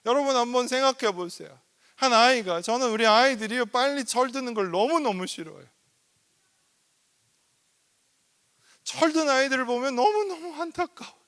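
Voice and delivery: loud, high impassioned sermon; full-force preaching. Acted. Pastor urging the congregation, loud and impassioned. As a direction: preach it out with fervor.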